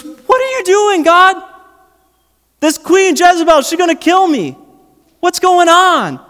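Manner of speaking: very high, strained panicky cry; mock panicked Elijah. Elijah fleeing Jezebel, high panicked cry to God. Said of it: "He goes".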